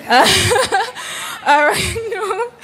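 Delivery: high giggle breaking through the words; giggling after joking she can't do the accent; laughing it off. Real, not acted. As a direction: laugh while brushing the idea away. Reader laughs off doing the accent.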